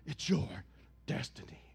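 low voice, breathy on destiny; mock movie-trailer intone; Disney trailer voice. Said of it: "Disney Corporation".

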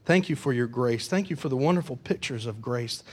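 low, soft pastoral prayer. Real, not acted. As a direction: speak humbly to God in thanks. Leads closing prayer.

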